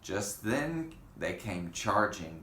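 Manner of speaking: ordinary delivery, nothing marked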